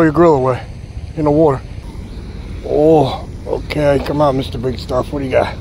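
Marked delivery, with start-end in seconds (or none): none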